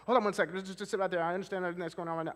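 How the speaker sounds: the wrong way, frantic and hurried; rushed and anxious. Shown as a demonstration. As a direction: rush it, panicky and high, keeping them on edge. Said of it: "If I talk like that".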